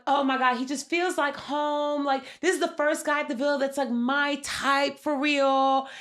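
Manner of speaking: lightly mock the gushing crush; mocking a smitten contestant. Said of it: "Literally saying stuff like".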